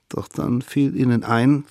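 plain reading voice

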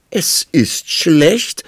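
high, loud, very drawn-out words; insist, stretching each word high and loud; insistent queen. A narrator's reading. Queen carrying on the quarrel, loudly insistent. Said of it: "setzte die Königin fort".

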